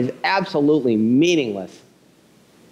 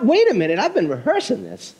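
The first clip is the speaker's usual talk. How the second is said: hurried son; 8 semitones higher than usual. Retold or imitated. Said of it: "The kid's trying to fire off".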